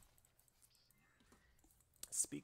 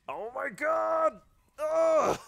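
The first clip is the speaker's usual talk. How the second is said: slow, drawn-out high squeal; stunned shriek; 16 dB louder, much slower than usual. Real, not acted. Gamer shrieking at his own clutch play. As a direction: disbelief spilling out.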